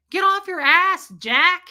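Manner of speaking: high, loud telling-off; retold scolding. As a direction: tell the lazy husband off.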